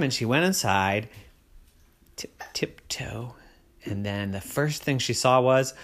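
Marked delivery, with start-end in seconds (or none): 2.2-3.4 slow storybook whisper. A narrator's reading. Tiptoeing into dragon school.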